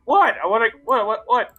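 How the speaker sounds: high startled what; baffled repeated whats; surprised. Real, not acted. Player surprised, high loud.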